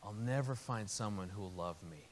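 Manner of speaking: put-on quiet, understated heartbreak of a child; tearful child, retold. Heartbroken five-year-old to her daddy, understated tearful sadness. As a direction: softly imitate a sad little girl. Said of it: "just big, big tears".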